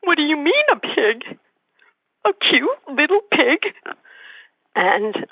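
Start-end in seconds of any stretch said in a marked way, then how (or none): 0.0-3.7 put-on high child's voice in dismay; shocked child. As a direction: raise it high like a horrified little girl. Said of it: "and she said".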